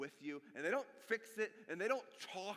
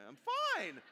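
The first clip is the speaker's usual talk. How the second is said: "fine" pitched up high and falling, a forced cheery lie; fake I'm fine; 10 semitones higher, 6 dB louder than usual. Shown as a demonstration. Pastor showing how people say "fine" when they're lying, voice going up high. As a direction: lift the voice up on "fine" to show the lie. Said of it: "Whenever your voice goes up when you say".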